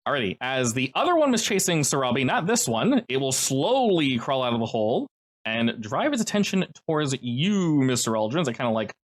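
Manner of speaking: ordinary talk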